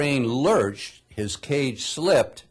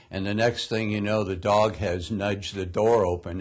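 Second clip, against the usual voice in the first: ordinary talk, nothing marked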